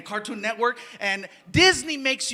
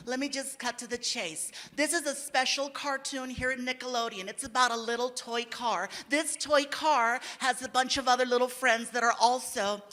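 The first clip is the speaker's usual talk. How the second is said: lightly voiced director. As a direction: explain it like a careful director. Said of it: "She's like".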